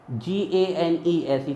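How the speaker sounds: normal talking voice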